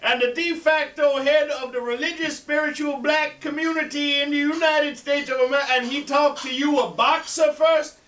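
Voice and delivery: furious high-pitched oratory; Jesse Jackson. Acted. Joke teller playing an outraged coalition leader, high and furious. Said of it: "Jesse Jackson is furious".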